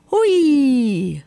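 high, gleeful whee; drawn-out happy cry; loud call. A narrator's reading. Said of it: "rief er laut".

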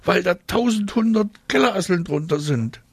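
put-on high squeaky girl voice; high-pitched disgusted child; disgusted squealing. A narrator's reading. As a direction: squeal the reason, grossed out. Girl complains in disgust to father.

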